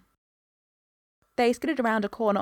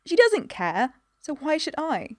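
put-on high, emphatic young protest; 7 semitones higher, 4 dB louder than usual. A narrator's reading. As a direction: argue back with raised pitch. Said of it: "Jane had protested".

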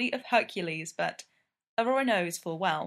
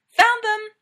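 much louder and higher, calling out in triumph; triumphant cry; 13 semitones higher, 11 dB louder than usual. Acted. Ella calling from the next room, loud triumphant cry. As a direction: shout it from the other room like a find. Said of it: "Aurora hears her cry".

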